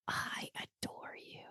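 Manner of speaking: soft breathy whisper, almost no voice. Retold or imitated. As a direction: breathe the words as a secret. Silent loving message from daughter.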